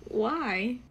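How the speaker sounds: normal talking voice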